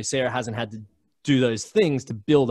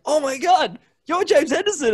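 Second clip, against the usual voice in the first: very high, loud starstruck squeal; starstruck fan; 6 dB louder than usual. Retold or imitated. Bartender recognizing the podcast host, very high and starstruck. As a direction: squeal like a fan meeting a celebrity.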